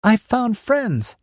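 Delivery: high, slow, carrying call. Acted. Ritva calls out to camp.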